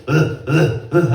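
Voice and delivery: short, punchy comic grunts; mock sex grunts. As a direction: grunt in hard bursts, hammed-up. Mimics sex grunts in the act.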